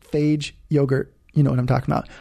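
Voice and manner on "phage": phage said as "fayj"; deliberately incorrect pronunciation. Real, not acted. Host naming yogurt for listeners, phage said wrong as "fayj". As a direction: say phage as one syllable, rhyming with "page". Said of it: "you have to say it incorrectly".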